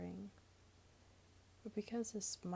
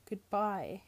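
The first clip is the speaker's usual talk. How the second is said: curt goodbye; 9 dB louder than usual. Acted. Sarah curtly ends the talk.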